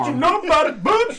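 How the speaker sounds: high, loud sassy retort; sassy high comeback. Pretend. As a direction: snap back with high attitude. Mock sassy comeback to friend.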